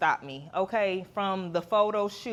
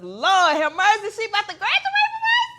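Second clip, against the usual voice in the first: much higher, louder, near tears; overwhelmed; 12 semitones higher, 8 dB louder than usual. Real, not acted. Mother about her daughter graduating, high overwhelmed squeal. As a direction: squeal it out, overcome with pride.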